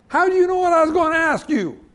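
high, loud, comic protest; mock indignation. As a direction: protest loudly as if wronged by the quick answer. Acted indignation at premature answer.

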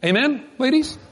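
ordinary talk

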